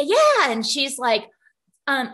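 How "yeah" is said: high, loud mock reply; answering call. Replying to the neighbor's call with a high, loud yeah. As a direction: sing it back across the street.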